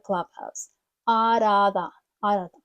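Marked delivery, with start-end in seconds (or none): 1.1-2.6 "Out of the" blended into "ar-athe"; linked words. Shown as a demonstration. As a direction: slur "Out of the" into one quick word. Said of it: "виходить таке щось out of the".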